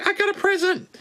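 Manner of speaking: very high squeaky puppet bat; Wuhan bat voice. Pretend. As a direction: squeak out hellos like a tiny bat. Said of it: "The Wuhan bat".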